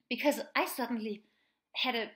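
normal talking voice

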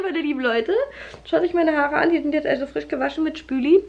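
ordinary talk

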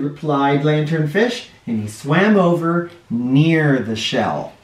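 usual speaking voice

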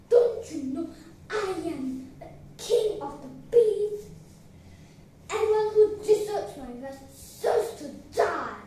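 roaring with indignant anger; angry roar. Acted. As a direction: thunder it out with heavy, emphatic threat. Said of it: "roared the lion in anger".

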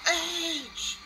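name him with a hype call; excited call. Real, not acted. Host calling out the returning star's name.

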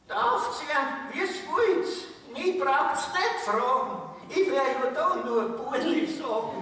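put-on high-pitched, emphatic old relative; great-grandmother's punchline, pitched well up; great-grandmother piping up. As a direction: chime in high and insistent. Great-grandmother finally gives her opinion.

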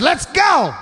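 high, yelled order; pissed wife. Retold or imitated. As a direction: imitate an angry wife demanding to leave. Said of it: "she looks pissed".

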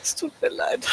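breathy whispered apology; whispering. Acted. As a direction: whisper it, half teasing. Whispered apology after shooting.

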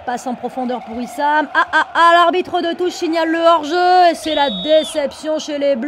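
excited sports commentary. Acted. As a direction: call the offside like TV. Commentary of offside.

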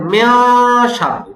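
মিন শাররি said too hard; মিন শাররি, overdone; too-hard mistake. Shown as a demonstration. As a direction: exaggerate the hard ikhfa in মিন. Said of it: "না, এত কঠিন না".

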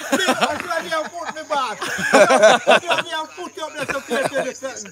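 talking through bursts of real laughter; laughing. Real, not acted. Joking with the hosts on the birthday show, laughing.

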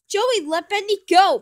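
high, very loud shout; yelling. A narrator's reading. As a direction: yell a sharp order to stop. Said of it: "Boris yells".

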